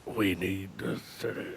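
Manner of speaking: low, very quiet gravelly growl; gravelly Kingpin. Retold or imitated. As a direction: imitate a crime boss rumbling under his breath. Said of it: "a little bit of that gravelly".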